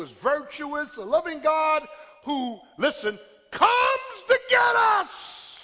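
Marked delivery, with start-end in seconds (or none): 3.5-5.6 high, drawn-out preacher's shout; full-force preaching. Real, not acted. Preacher proclaiming God comes for the fallen, full-force preaching. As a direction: sustain it like a pulpit cry.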